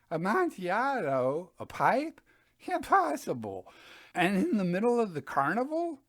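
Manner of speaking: put-on high, incredulous drunk friend; excited, disbelieving reveller; astonished. Fortunato astonished at the news.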